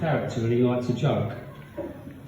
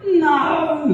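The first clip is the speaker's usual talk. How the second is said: much higher, louder granny no; old lady no; 14 semitones higher, 6 dB louder than usual. Retold or imitated. Old woman refusing yet another vibrator, high and loud. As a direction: squawk it like a stubborn granny.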